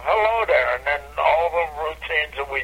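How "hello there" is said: stage greeting. Retold or imitated. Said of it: "look at 'em and go".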